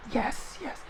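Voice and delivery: high, soft little voice; imitated child's yes. Retelling daughter's answer to Mel, high and soft. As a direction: pitch it way up, softly.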